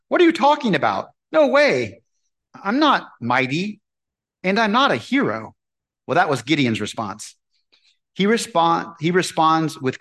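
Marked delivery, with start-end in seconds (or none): 0.1-5.5 incredulous protest. Pretend. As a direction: push the praise away, stunned. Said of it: "Or would you say".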